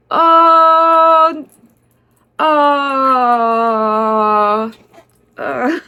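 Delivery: a hint of a caught-out man stammering; flustered stammer. Imitating the staff man caught off guard, stammering. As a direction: stretch out the uh, uh, uh nervously. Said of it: "his response was so funny".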